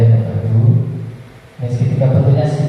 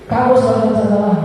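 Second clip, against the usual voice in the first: high-pitched, quick blaming; demonstrated punisher's telling-off; rapid scolding; 8 semitones higher than usual. Shown as a demonstration. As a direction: fire off the reprimand in one high run. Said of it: "Saking cepatnya tidak terdengar".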